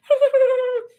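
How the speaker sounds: short high burst of laughter. Real, not acted. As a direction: laugh at your own bluntness. Laughs at own story.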